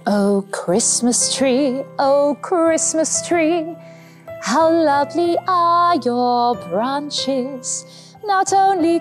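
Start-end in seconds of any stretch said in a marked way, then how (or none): none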